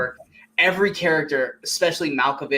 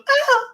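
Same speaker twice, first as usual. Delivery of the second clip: high, loud mock scream; imitated yelp; 5 dB louder than usual. Retelling the punch scene, high imitation shriek. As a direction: squeal as if just hit. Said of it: "She goes".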